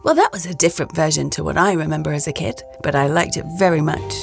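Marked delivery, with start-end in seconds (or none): none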